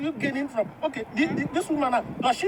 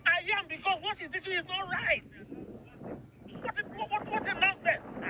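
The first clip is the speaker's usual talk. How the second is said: angry; 6 semitones higher, 8 dB quieter than usual. Acted. Husband objecting to the woman's teaching, angry. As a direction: fume in a contained voice. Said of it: "Why are you so angry about it".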